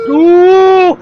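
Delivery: wincing ooh, high and loud; startled commentator ooh; sharp high ooh. Real, not acted. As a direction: react to the crash with a yelp. Reaction to off in race.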